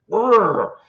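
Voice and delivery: high imitated brr noise; beastly growl. Showing how mean the boar was, imitated growl. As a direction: growl playfully like a butch boar.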